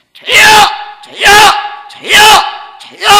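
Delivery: short, repeated cries of invocation; shouted prayer cry. Shown as a demonstration. Preacher showing youths how to cry out to God, short shouted bursts. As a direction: call out sharply in bursts.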